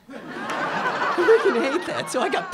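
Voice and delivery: laughing it out, slow and high. Real, not acted. Comic laughs at the owner's pity, laughing.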